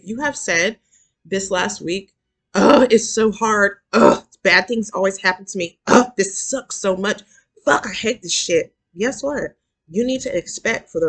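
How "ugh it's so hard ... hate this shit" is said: loud, groaning complaint in imitation; mock exasperated griping. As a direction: act out someone fed up and whining loudly. Acts out listener's negative complaining.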